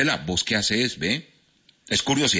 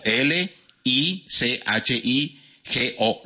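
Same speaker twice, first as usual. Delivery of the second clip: spelled out slowly; 3 semitones higher, much slower than usual. Shown as a demonstration. Spelling the answer.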